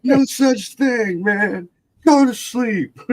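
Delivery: high, slow mock yell; exasperated scolding. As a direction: order the kids to bed, half laughing. Acted telling-off of scared brothers.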